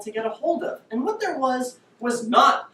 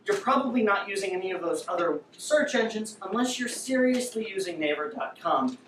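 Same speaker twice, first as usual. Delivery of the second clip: normal talking voice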